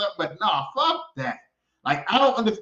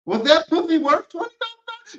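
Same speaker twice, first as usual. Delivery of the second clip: incredulous; 9 semitones higher, 5 dB louder than usual. Retold or imitated. Contestant asks incredulously.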